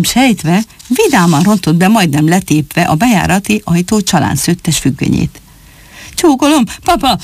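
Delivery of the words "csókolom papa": higher, eager shout out of breath from running; a child yelling hello after a sprint; breathless call. Acted. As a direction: burst in calling to papa, panting. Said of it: "kiáltotta a futástól kifulladva".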